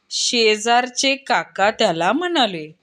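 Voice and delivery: ordinary talk, nothing marked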